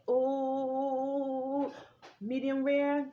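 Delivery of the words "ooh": ordinary delivery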